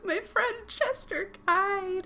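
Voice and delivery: high-pitched, drawn-out fake weeping; hammed-up sob, close to laughing; mock tearful. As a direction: wail it as a jokey tearful lament. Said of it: "on the verge of tears".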